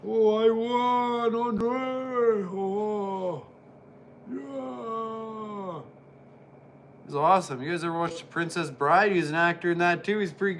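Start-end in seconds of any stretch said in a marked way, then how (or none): 0.1-5.8 higher, slow, drawn-out victory cry; mock winner cheer. Voicing Andre celebrating his win, high drawn-out cheer. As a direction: gloat like the giant who just won.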